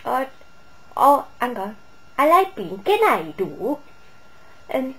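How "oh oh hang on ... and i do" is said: loud, hammed-up Welsh lilt; attempted Welsh accent. Mimicking the actress's acting, attempted Welsh accent. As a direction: have a rough go at mock Welsh. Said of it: "trying to do an accent".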